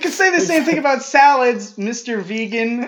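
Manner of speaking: high, loud laughing retort; squealing with laughter. Real, not acted. Laughing mock argument.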